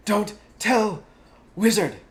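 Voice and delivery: high, loud dog whine; whining. Acted. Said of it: "he whined".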